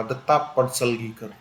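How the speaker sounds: ordinary talk, nothing marked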